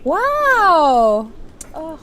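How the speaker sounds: loud, high amazed wow; genuinely struck with wonder. Real, not acted. Amazed at couple still together.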